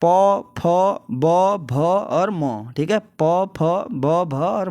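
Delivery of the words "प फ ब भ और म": प, फ, ब, भ, म said very slowly with the lips; reciting lip letters. Shown as a demonstration. Teacher demonstrating the lip letters slowly. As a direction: press the lips for each letter. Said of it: "ओष्ठ से हो रहा है".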